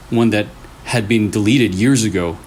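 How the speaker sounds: usual speaking voice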